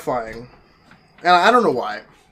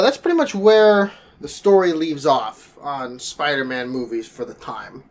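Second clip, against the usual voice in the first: usual speaking voice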